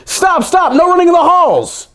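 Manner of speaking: very high, loud mock yell from the principal; cartoonish high-pitched principal shout. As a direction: shriek down the hall at the runner. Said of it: "The janitor ran after George".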